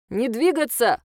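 loud robotic command over speaker; drone loudspeaker. Acted. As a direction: bark it like a police drone. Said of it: "повторил дрон".

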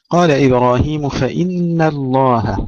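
ordinary talk

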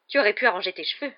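fast, loud snapped criticism; piercing reproach. A narrator's reading. Aunt criticising her nephew's hair, piercing and quick. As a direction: throw the reproach out sharply and quickly. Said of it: "la voix perçante de la tante Pétunia".

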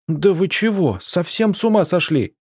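high-pitched indignant outcry; protesting wail. A narrator's reading. Arina stands up for the accused, wailing in protest. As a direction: cry out in protest, voice pitched well up. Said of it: "протестующе завопила она".

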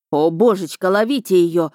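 usual speaking voice

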